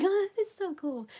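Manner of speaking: high, loud, laughing amazement; amazed. Retold or imitated. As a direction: squeal it like you just saw it glow. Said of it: "I was like".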